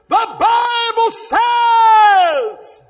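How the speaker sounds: slow, drawn-out booming proclamation; booming preacher shout. Retold or imitated. Street preacher addressing bikers across the street, slow booming shout. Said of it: "my voice hit that building with such force".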